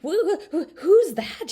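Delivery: high, loud startled stutter; startled girl stuttering at a hidden voice. A narrator's reading. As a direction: trip over the who's in fright. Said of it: "she stuttered".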